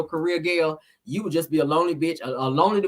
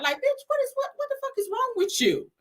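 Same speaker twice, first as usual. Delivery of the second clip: exasperated outburst; 3 dB louder than usual. Real, not acted. Scolding Destiny's livestream.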